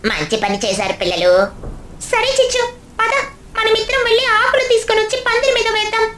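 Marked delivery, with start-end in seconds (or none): 2.1-6.0 put-on high, bright-eyed little kid voice; child voice. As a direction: speak like an eager small child. Character proposes covering pandal. (చిన్న పిల్లాడి గొంతు)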